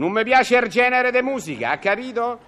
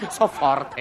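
laughing boast, lower; 9 semitones lower than usual. Acted.

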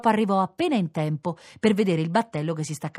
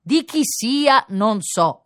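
very slow, loud, raised pitch; deliberate declaration; 6 semitones higher, 5 dB louder, much slower than usual. A narrator's reading. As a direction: declare it word by word. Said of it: "dichiarò il pescatore". (dichiarazione scandita lentamente)

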